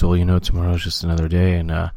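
low-pitched impression of a former manager; Mike Matheny. Co-host correcting the impression of the ex-manager, low voice. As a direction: drop the voice low for a platitude-spouting coach. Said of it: "he would sound like this".

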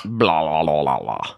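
low, descending string of blahs; mock tribe noise. Player imitating the Blah tribe's sound, low mock chant. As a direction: drone it out low, like a silly tribe. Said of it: "that's the sound my tribe made".

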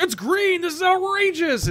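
high whiny mock outrage; mocking outraged fans. Mocking fans upset about the green suit, high whiny mock outrage. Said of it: "everybody who was like".

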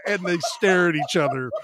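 normal talking voice

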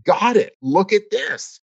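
light up as it finally clicks; sudden realization. Retold or imitated. Realizing his own expectation after Landmark, a hammed-up aha. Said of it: "I went".

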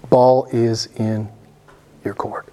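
"Ball is in" word by word, dropping on "your court"; slow, deliberate. Real, not acted. Preacher leaves the choice to them, slow and deliberate.

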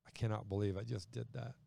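remorseful. Retold or imitated. Peter's imagined remorse.

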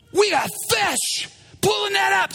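high, urgent acted command; excited shout. Peter discovering the catch, excited. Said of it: "Peter's like".

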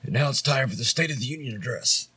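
lightly imitated frontman announcement; stage banter. Singer introducing a song to the crowd, mock announcement. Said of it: "sometimes the shit will say".

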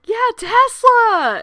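high, loud, slow drawn-out cheer; teasing cheer. Retold or imitated. Friend calling out the movie's name, teasing cheer. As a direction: cheer the title with a smirk. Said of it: "she's like".

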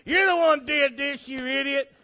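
loud, high scolding, slowing at the end; mock marital quarrel. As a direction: blame your spouse in hammed-up irritation. Acted quarrel of new parents.

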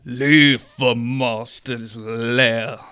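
slow booming monster roar; monster shout. Acted. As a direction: bellow it slowly, mouth full of prey. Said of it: "it's gonna shout".

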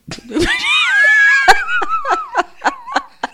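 very high squealing laugh; long, high-pitched laughing fit; helpless laughter. Real, not acted. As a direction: lose it completely. Cracking up at own word.

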